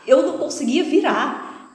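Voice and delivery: ordinary talk, nothing marked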